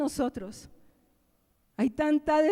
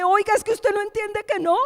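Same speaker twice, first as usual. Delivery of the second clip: exasperated scold; 9 semitones higher, 10 dB louder than usual. Retold or imitated. Scolding the deceived child.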